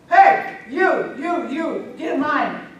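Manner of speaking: high, loud shouted order; hammed-up angry bear calling out offenders; mad. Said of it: "and she got mad".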